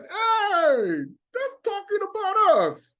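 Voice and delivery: suddenly recognising yourself in the text; high-pitched eureka; surprised realisation. Retold or imitated. Reader realising scripture describes them.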